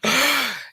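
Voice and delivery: a loud, high mock gasp; theatrical intake of breath; sharp gasp. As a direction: gasp as if startled. Reacting to the dog scare.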